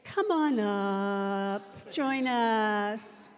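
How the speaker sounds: ordinary delivery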